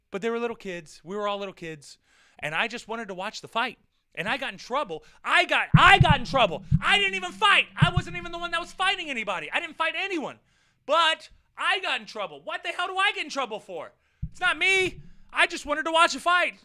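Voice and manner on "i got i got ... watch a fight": higher, louder indignant complaint; indignant rant. Real, not acted. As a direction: protest the injustice heatedly. Indignant rant about unfair blame.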